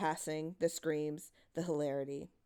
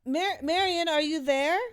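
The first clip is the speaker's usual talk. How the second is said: much higher, louder frightened call; 10 semitones higher, 7 dB louder than usual. A narrator's reading. Louise calling for Marion in the dark, frightened. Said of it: "Louise called".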